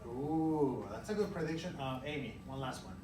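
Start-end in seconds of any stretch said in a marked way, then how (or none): none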